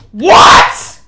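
loud, high, rising yell; shouted disbelief. Real, not acted. Streamer stunned by what just happened in game, disbelieving shout.

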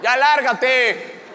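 loud but slow, drawn-out echo; feeble echoed insult. Acted. Comedian echoing his rescuer from afar, mock-tough drawl. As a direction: copy a tough order without the fury.

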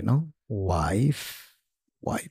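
ordinary talk, nothing marked

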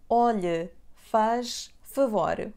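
"Olhe" then "faz favor" drawn out slowly; slow clear demo. Shown as a demonstration. Teacher spells out the phrase slowly for learners. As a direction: break it up and stretch each word. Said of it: "here are the sentences you may want to use".

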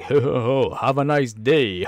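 slow, jolly commercial voice; hammed-up ad spokesman; imitating a jolly pitchman. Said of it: "seven up commercials like".

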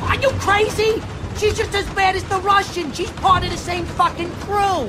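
high, frantic protest; frantic disbelief, pitched high; panicked outburst. Acted. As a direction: yelp it in disbelief.

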